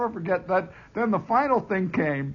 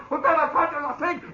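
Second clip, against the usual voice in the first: mock holler; 11 semitones higher, faster than usual. Soldier yelling again.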